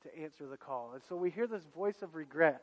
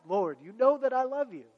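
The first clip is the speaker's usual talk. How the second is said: louder and higher, insistent; feisty reply; 6 semitones higher, 9 dB louder than usual. Retold or imitated. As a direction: push back, a bit stung. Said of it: "Peter gets a little more feisty".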